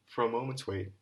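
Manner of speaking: ordinary delivery, nothing marked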